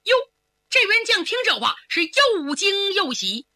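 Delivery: ordinary delivery, nothing marked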